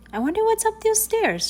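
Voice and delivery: very high wondering thought; curious little girl. A narrator's reading. Girl wondering about the staircase, high curious voice. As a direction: think aloud in high, girlish curiosity.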